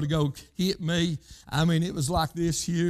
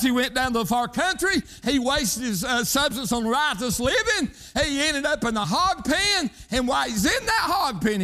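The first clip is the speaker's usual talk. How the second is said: preaching at full force; 7 semitones higher than usual. Acted. Preacher pressing the congregation, fervent rising cry. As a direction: preach it out, building fervor.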